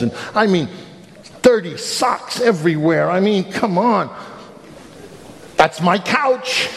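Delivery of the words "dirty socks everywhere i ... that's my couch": higher, slow disbelief; can't believe the mess; father staring at the buried couch; incredulous dismay. Real, not acted. Dad shocked at mess.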